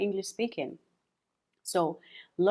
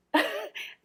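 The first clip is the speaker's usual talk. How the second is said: laughing at own stumble. Real, not acted. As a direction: burst of high laughter. Laughs at own tongue twister.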